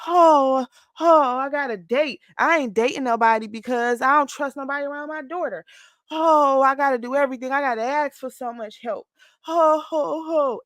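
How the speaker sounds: high, moaning mock complaint; woe is me whining. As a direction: whine like a self-pitying younger you. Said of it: "this woe is me".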